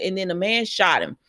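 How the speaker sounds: ordinary talk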